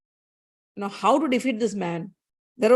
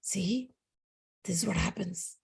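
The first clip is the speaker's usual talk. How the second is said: very soft, low aside; gossiping under the breath; hushed gossip; 3 semitones lower, 11 dB quieter, slower than usual. Retold or imitated. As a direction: murmur it like village gossip.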